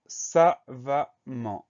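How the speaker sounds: "savamment" slowly split into sa-va-mment; very slow, separated syllables in "savamment"; sounded out syllable by syllable. Shown as a demonstration. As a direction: dictate "savamment" one slow syllable at a time. Teacher sounds out word for dictation.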